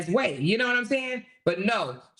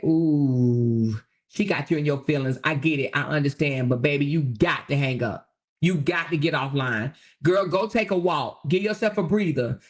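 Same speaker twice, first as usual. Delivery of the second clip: lower, soothing big-sister voice; soothing friend; 4 semitones lower than usual. Pretend. Friend on the phone calming an upset creator, soothing.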